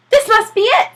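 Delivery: high, loud eager voice; high excited reporter; excited young man. Acted. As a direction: spot your target with glee.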